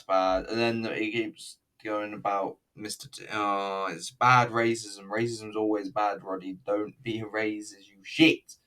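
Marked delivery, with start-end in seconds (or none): none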